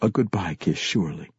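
usual speaking voice

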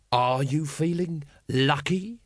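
drawn-out taunt; a slow lightly voiced threat; slow menacing challenge. A narrator's reading. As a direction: dare them slowly. Vimes menacing the mob.

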